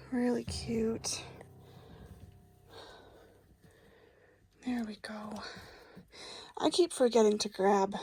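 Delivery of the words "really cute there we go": soft whisper. Real, not acted. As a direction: breathe it, barely voiced. Whispering near sleeping dolls.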